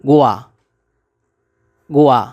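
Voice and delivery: "গোয়া" as gu-a, firm and falling; shown pronunciation. Shown as a demonstration. Teacher gives learners the Chinese word for country, "গোয়া" said gu-a, falling. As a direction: model "গোয়া" as gu-a, with a pause before repeating. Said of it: "Bangla উচ্চারণ হবে".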